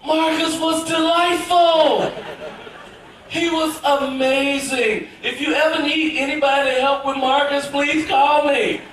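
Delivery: slow, high, smooth-talking suitor; oozing charm at the beautiful mom, slow; smooth flirty charm. Retold or imitated. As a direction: lay on the charm to get a date. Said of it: "I was like".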